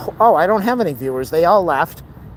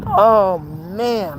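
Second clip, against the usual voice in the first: normal talking voice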